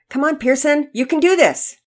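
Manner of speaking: high, loud cheering; encouraging shout. A narrator's reading. Coach and dad to young batter, encouraging shout.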